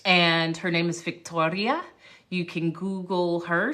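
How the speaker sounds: ordinary talk, nothing marked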